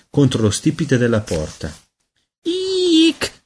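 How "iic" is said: very high squeak; baby squeak. A narrator's reading. Peter flying toward the doorframe, high squeak. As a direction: squeal in fright. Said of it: "squittì".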